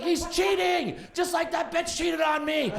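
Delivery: high mock shout of betrayal; hammed-up yell about cheating; imitating a furious fan. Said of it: "And he's like".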